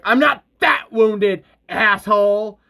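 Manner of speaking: loud, drawn-out snarl, pitched high; angry creature snarling back at its attacker. A narrator's reading. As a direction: snarl it out indignantly as a wounded creature. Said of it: "snarling like".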